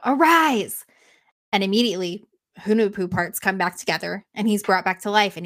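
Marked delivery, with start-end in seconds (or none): 0.0-0.8 higher, louder commanding yell; commanding mock yell. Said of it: "he yells out".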